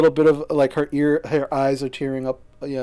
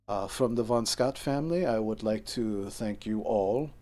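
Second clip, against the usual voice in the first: lower, softer, measured formal voice; a tall armoured son speaking gravely and quietly; dignified son; 4 semitones lower, 6 dB quieter than usual. Acted.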